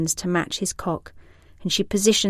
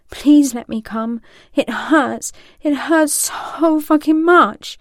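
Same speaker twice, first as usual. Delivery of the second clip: higher, louder pleading, slowing to a strained cry; desperate calling out; 8 semitones higher, 7 dB louder, slower than usual. Acted. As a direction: call out in mounting desperation. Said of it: "as he calls to her".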